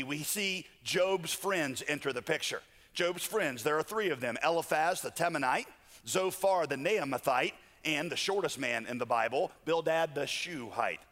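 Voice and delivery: ordinary delivery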